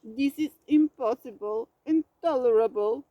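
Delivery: loud, rising bear roar; starting to roar. A narrator's reading. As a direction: roar your outrage but run out of strength. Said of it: "Bear started to roar".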